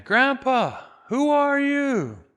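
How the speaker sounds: high, loud call, slowing on the question; yelling again. Retold or imitated. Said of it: "And he yells again".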